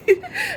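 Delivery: sudden high-pitched laugh; a quick squeaky laugh through tears. Real, not acted. Vlogger laughing at her own delusion, high laugh.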